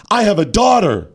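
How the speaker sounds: high mock-righteous protest; indignant player. Host mocking a player's appeal to the umpire, mock indignant. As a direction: imitate an aggrieved player pleading her case. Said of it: "got indignant over and over".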